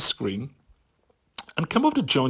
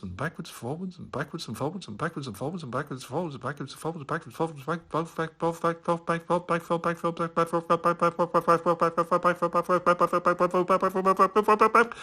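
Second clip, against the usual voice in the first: speeding up; 5 semitones higher than usual. Shown as a demonstration. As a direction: gabble faster and faster into a blur. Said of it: "with increasing speed".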